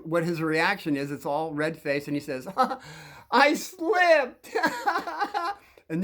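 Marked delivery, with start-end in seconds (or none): 2.5-3.0 laugh. Real, not acted. Laugh before Marty's excuse. 3.3-4.5 squeaky, much higher and louder, laughing; mocking the bully. Narrator mocks the bully caught by the nuns, squeaky. Red-faced bully's excuse mimicked. 4.5-5.5 laugh. Real, not acted. Laugh after Marty's excuse.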